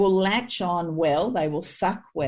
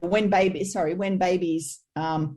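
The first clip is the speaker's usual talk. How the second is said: ordinary delivery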